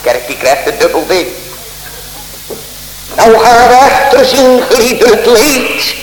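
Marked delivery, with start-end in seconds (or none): 3.1-5.9 high, ringing preacher's voice; preaching at full force. Acted. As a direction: lift the congregation up, preaching hard. Preacher raises voice. (vurig preken met verheven stem)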